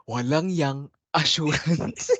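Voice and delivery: slow, laughing repeat; laughing through it. Retold or imitated. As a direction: giggle the words out slowly. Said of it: "Naalala ko bigla sa pagkasabi mo".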